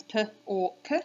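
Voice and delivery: "p or k" as three separate sounds, puh, or, kuh; sounding out. Shown as a demonstration. Teacher shows parents a digraph, sounding out "p or k". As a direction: segment "p or k", with "or" as one sound. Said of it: "If we say our sounds".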